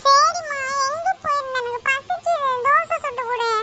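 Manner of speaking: put-on high, impatient child's nagging; whining demand. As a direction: pester mom for food in a high whine. Hungry child demands food from mother.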